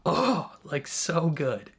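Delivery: high, delighted gush about the writing. Real, not acted. Fan gushing to viewers about the writing, excited. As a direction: rave like a thrilled fan.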